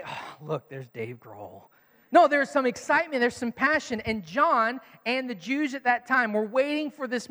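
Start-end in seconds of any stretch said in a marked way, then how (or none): 0.0-1.7 low, much softer deadpan; bored monotone; flat, unexcited. Shown as a demonstration. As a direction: mention a star like it's nothing. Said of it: "I don't just say".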